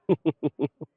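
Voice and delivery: a short, loud burst of laughter at your own joke. Real, not acted. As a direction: laugh out loud, a bit self-amused.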